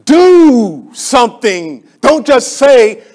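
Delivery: loud, drawn-out stress on Do; emphatic slow command. Real, not acted. Preacher pressing the church to act. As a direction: hammer each word home slowly.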